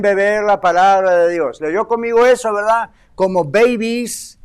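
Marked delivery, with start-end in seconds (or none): 3.1-4.4 drawn-out babies. Acted. Preacher savoring the baby image.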